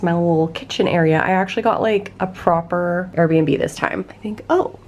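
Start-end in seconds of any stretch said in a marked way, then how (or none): 4.5-4.8 excited. Real, not acted. Finds water left in Airbnb.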